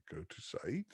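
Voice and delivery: whispered note to himself; soft whispered aside. Real, not acted. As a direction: breathe it out under the breath. Muttering to himself while checking.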